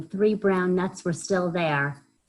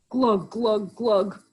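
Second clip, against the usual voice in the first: higher, louder glug glug gurgle; gurgling; 6 semitones higher, 4 dB louder, much slower than usual. Acted. Hedgehog bobbing in the pond, glugging gurgle. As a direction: gurgle like swallowing pond water. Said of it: "gurgled Hedgehog".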